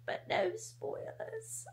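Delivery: slow high whisper; secretive slow whisper. Real, not acted. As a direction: tease them in a hush. Whispered tease.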